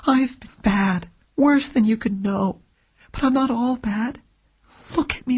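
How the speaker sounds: put-on a frightened young woman, quickening toward the end; desperate young woman pleading. A narrator's reading. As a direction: beg him to trust her, voice rising. Client begs detective for trust.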